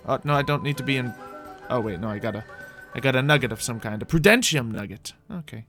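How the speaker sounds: normal talking voice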